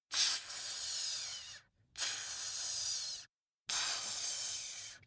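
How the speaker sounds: soft, long snake hisses; cobra hiss. A narrator's reading. Cobra slithers up to the boy, soft hissing. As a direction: hiss three times like a snake.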